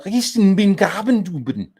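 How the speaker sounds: loud, high fake German; gibberish German. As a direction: ham up nonsense German, high and slow.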